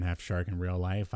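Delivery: ordinary delivery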